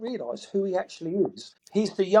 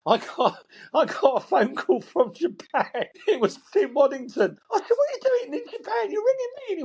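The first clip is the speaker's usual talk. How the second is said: very high voice breaking into laughter; laughing retelling; 12 semitones higher than usual. Real, not acted. Storyteller recalling a surprise phone call from Japan, laughing. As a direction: tell it while cracking up, pitch shooting up.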